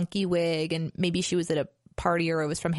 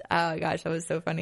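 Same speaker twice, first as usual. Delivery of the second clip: usual speaking voice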